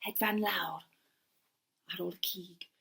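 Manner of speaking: ordinary delivery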